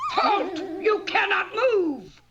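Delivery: high, loud witch's command; loud, high witch voice catching a thief; witch's shout. Acted. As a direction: freeze the thief with a shrill-free loud halt.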